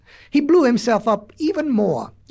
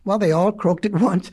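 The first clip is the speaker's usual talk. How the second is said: usual speaking voice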